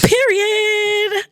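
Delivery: drawn-out high period; high excited period. Acted. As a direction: sing out the correct answer. Host confirms correct answer.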